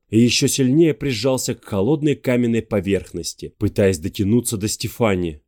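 plain reading voice

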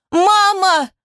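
very high wailing call; little girl screaming for mother; anguished child's cry. A narrator's reading. Daughter carried away from mother, anguished wail. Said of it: "Плач Алисы становился надрывистее".

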